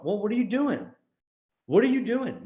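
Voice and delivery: high-pitched, incredulous scolding; exasperated challenge. Acted. Marketer challenging lazy guys over calls, exasperated.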